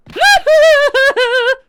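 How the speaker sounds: long, very high, loud shriek; sustained acted cry of terror; panicked scream. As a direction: scream as the tiger pounces. Tiger attack.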